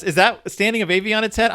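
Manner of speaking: ordinary delivery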